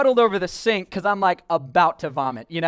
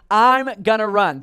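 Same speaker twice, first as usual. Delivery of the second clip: bold declaration; 3 semitones higher, 7 dB louder, slower than usual. Retold or imitated. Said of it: "This was kinda my statement".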